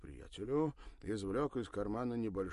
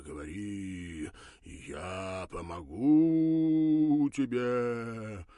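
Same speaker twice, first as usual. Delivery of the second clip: slow, loud, eerie hypnotist; ghostly wail; 4 semitones higher, 11 dB louder, slower than usual. Acted. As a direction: intone like a mystic mage. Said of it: "войдя в роль то ли мага".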